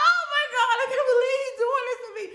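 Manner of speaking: high, loud, fast laughing squeal; hammed-up freak-out through laughter; mock disbelief. Patient's disbelief during exam.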